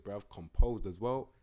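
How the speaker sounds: ordinary delivery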